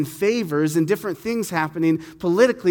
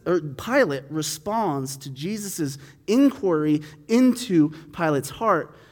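ordinary talk

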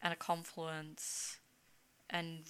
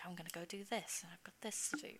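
whispered to herself, quick; hushed inner voice; 9 dB quieter than usual. Retold or imitated. Whispering her own busy plans to the host. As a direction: whisper it like a private to-do list. Quoting herself planning tasks.

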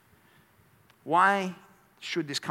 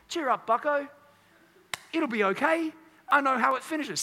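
a breezy, higher mock British-Australian accent; weird British-Australian mix; 7 semitones higher than usual. Preacher mocking a careless God in a British-Australian accent. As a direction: toss it off like a chirpy British-Australian bloke. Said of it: "weird Brit-, British, like, Australian mix".

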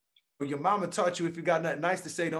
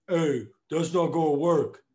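mock naysayer; 5 dB louder, slower than usual. Mocking a discouraging roommate.

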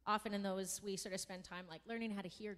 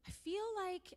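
a light hint of a hesitant little girl, higher; shy child; 10 semitones higher, slower than usual. A shy pupil telling her teacher, lightly voiced. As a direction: voice the timid pupil, unsure. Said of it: "She's like".

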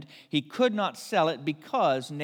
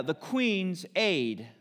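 ordinary talk

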